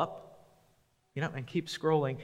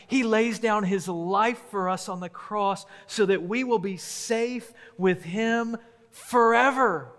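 rising preaching; 5 semitones higher than usual. Acted. Pastor to congregation about the shepherd, building passionate preaching. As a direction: preach it up to full force, slowing on the last phrase.

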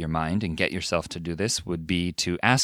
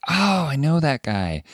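higher, drawn-out "oh" of recognition; delighted recognition; 7 semitones higher than usual. Acted. Viewer hearing a known voice in animation, delighted recognition. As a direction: act out suddenly placing a familiar voice.

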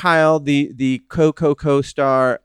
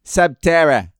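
put-on Boston accent; 3 dB louder than usual. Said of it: "New England, uh, version of Subterror?".

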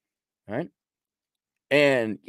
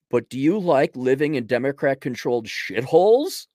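ordinary talk, nothing marked

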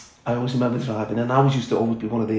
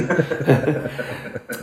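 real laugh; 4 semitones higher than usual. Real, not acted. Laughing at losing the job.

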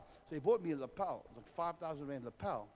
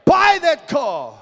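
loud, higher commanding shout; preacher showing how to command money; aggressive command; 10 semitones higher, 21 dB louder, slower than usual. Shown as a demonstration. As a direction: order the money to bring it. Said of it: "Tell the money".

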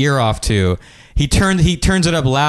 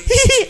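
tiny very high mock giggle; very high "hee hee" villain titter; acted psychopath giggle. Psychopath walks over to stab him, high mock giggle. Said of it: "walks over and he goes".

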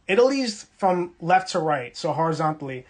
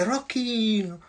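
family calling; 5 semitones higher, slower than usual. Retold or imitated. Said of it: "So they used to say".

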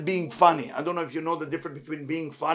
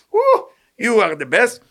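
drawn-out, louder, higher mock cheer; 5 semitones higher, 6 dB louder, slower than usual. Crowd fawning over a clown, mock cheering. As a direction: hoot it like an admiring crowd.

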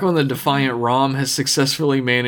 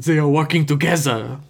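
a rough mock French accent on working together; an attempt at a Russian accent that slides into French; 2 semitones higher than usual. Podcast host voicing Anastasia in a shaky mock French accent. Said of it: "She's French now".

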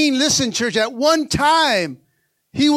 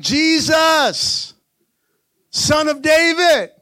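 raised, drawn-out cry for help; preacher acting out a shout; 4 semitones higher, much slower than usual. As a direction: cry out to Jesus, pitched higher. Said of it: "He shouted louder".

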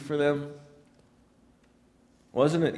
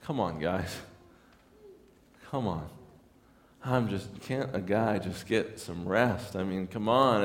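groggy mock complaint; 6 semitones lower, 7 dB quieter than usual. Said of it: "waking up and being like".

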